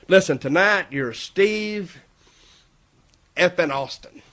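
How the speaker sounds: slow grand announcement of a new name; slow announcing. Retold or imitated. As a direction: spell the name out slowly like a big reveal.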